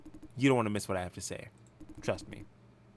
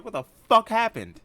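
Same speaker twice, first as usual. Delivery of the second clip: shocked shout; 14 semitones higher, 11 dB louder than usual. Acted. Bursts into hospital room.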